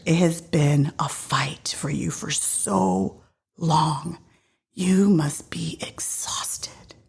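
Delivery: slow, half-whispered compassion; slow right down, hushed and tender; tender comforting. Acted. Jesus to the exhausted woman he healed, tenderly.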